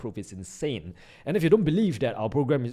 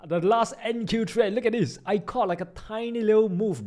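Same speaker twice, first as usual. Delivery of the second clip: higher, stretched-out hype; excited; 9 semitones higher, 4 dB louder than usual. Real, not acted. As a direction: show it off, thrilled. Showing off the trade.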